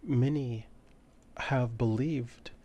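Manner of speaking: high and soft, an ancient being; mysterious entity. Acted. Ancient entity answers Beatrix softly in her mind. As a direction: speak softly, like something old and unseen.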